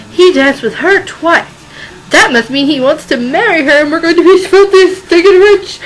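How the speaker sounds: high, loud, building glee; greedy gloating. Acted. Greedy mother gloats, rising.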